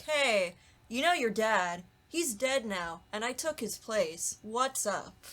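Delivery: ordinary talk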